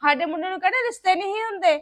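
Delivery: loud, indignant retort; forceful, aggrieved accusation at full voice; angry argument. Acted. Son argues with in-laws over dowry.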